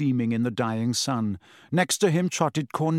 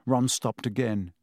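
ordinary delivery

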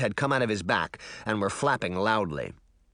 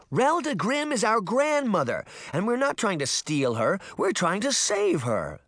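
high, insistent protest; urgent high-pitched outburst, slightly restrained; indignant shout; 10 semitones higher than usual. A narrator's reading. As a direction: shout the correction without full volume. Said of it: "Sabrina shouted".

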